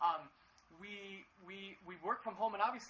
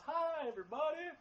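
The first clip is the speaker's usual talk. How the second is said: much higher, louder cheerful hello; chirpy morning greeting; 8 semitones higher, 4 dB louder than usual. Retold or imitated. Pastor imitating himself waking up, high and cheery. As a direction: bounce out of bed and greet the house. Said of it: "pops out of bed and goes".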